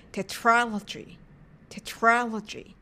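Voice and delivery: Tetralogy with stressed, drawn-out American A in "tra"; American vowel. Shown as a demonstration. Teacher demonstrating the pronunciation point, American A in Tetralogy. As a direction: slowly model Tetralogy, leaning on the second syllable. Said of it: "発音のポイントとしては、アメリカ円を使って".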